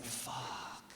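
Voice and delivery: whispered fuck, barely voiced; a dismayed whispered swear; hushed curse. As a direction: breathe it out in dismay. Realizing he's in trouble.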